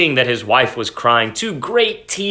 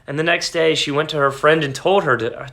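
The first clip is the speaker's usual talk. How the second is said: ordinary delivery, nothing marked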